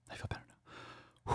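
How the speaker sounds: breathy whispered calm-down; mock whisper. Host pretending to calm himself down, whispered. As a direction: whisper it as a joke to yourself. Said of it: "I'm just fucking with you".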